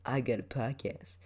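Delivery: lightly put-on smug bragging voice; bragging asshole. Mimicking how he doesn't want to sound, mock bragging. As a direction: show off like an asshole. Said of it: "sound like an asshole".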